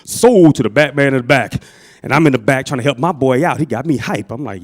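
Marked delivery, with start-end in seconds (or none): 0.0-1.6 loud auctioneer's closing call. Acted. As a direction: call the sale loudly to the room. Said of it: "he starts sounding like an auctioneer".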